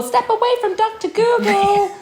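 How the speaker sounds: high, hammed-up cop command; mock police order. Friend warning herself off health searches, mock police order.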